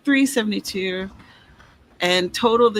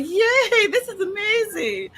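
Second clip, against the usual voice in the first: high, excited squeal of delight; laughing, high-pitched mock delight; gushing yay; 12 semitones higher, slower than usual. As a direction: squeal it like a giddy fan. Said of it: "So I'm like".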